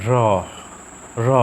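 র released with extra air, a light h at the end; aspirated R. Shown as a demonstration. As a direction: breathe out through the end of each র. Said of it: "বাতাসটা কিন্তু একটু বেশি নির্গত হচ্ছে".

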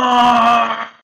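startled cry of alarm; scream. Acted. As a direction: let out a sharp scream. Player screams while fleeing.

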